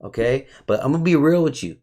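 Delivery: usual speaking voice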